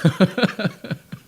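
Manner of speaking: a real burst of laughter. Real, not acted. Laughs after mock pious answer.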